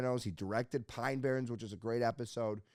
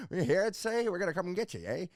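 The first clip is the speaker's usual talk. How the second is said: lightly put-on Prohibition gangster, higher and louder at first; old-time gangster voice; 8 semitones higher, 5 dB louder than usual. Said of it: "that's how you talk when you wear one of these hats".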